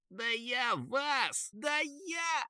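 faint, high, muffled fury; barely audible. A narrator's reading. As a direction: squeal threats quietly as if sealed inside ice. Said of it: "оттуда едва послышалось". (еле слышный сдавленный крик)

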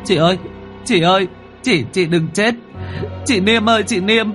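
high, anguished crying out over a body; wailing grief. A narrator's reading. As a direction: wail in protest for your dead sister. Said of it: "ôm lấy xác chị mà gào khóc". (gào khóc thảm thiết)

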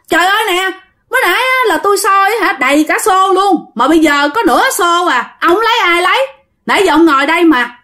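high-pitched aggrieved complaint; indignant protest. A narrator's reading. Frog catcher confronting his companion over a half-empty bucket, high indignant protest. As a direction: argue it high and insistently. Tư quarrels over the missing frogs.

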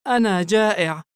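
put-on high, sad little boy; small hungry child, downcast. A narrator's reading. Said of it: "جلس الطفل بحزن".

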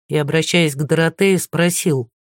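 usual speaking voice

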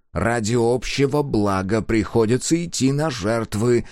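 plain reading voice

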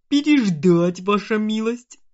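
loud, high orc shout. A narrator's reading. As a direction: bark it like an orc. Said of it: "поддержал гнома орк".

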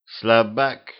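lightly voiced cyborg; a hint of a mechanical sneer; half robot voice. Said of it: "раздался голос киборга".

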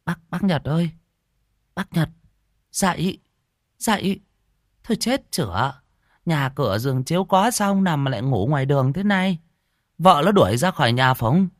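high-pitched village woman calling a man awake; big market woman scolding a man asleep on the road. Acted. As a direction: shake him awake with a high, nagging call. Market woman shaking Nhật awake.

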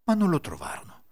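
ordinary delivery, nothing marked

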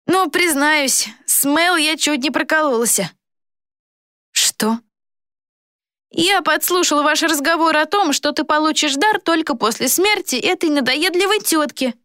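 put-on high-pitched gloating boy villain; boy traitor, confessing smugly. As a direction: admit it in a high, smug voice.

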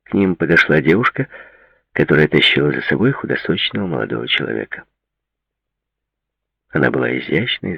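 ordinary delivery, nothing marked

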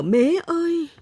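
high-pitched, acted wail for mother; wailing cry. A narrator's reading. Lost girl reaches home crying for mother, high acted wail. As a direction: cry out as if sobbing. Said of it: "Cô gào khóc nức nở".

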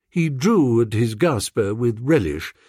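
ordinary delivery

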